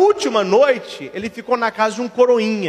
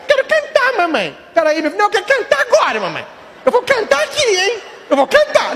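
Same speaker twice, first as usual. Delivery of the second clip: put-on insistent little boy; 11 semitones higher than usual. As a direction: demand it like a stubborn kid. Boy insisting loudly to mother.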